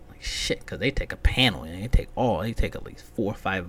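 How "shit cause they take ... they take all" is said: ordinary talk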